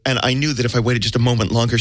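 ordinary delivery

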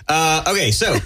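usual speaking voice

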